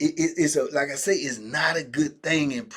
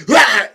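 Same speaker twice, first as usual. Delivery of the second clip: sudden loud roar to startle; scare roar; 6 semitones higher, 14 dB louder than usual. Retold or imitated. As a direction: jump out with a loud rah. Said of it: "try to scare him like".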